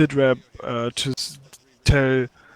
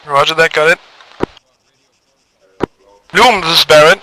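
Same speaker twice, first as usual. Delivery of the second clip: loud radio call-out; 4 semitones higher, 12 dB louder than usual. Acted. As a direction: call the unit over the net. Leader on radio.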